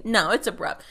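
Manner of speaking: ordinary talk, nothing marked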